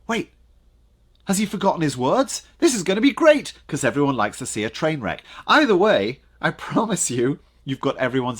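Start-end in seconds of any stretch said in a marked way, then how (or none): 0.0-3.5 acted listener, building to high, loud anticipation; gleeful onlooker. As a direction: start on a hanging "Wait", then relish the coming disaster. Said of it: "The other half will think".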